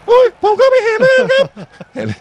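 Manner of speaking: laughing through a mock squeak, pitched far up; cracked-voice impression. Commentator laughs while mimicking Kane's cracked interview answer. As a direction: ham up a hoarse star's broken voice. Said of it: "เสียงหลงเลยอะ".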